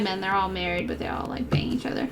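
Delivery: hushed, secretive whisper; conspiratorial whisper; whispered aside. Real, not acted. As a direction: drop to a whisper for the juicy part. Gossipy aside about Mad Men.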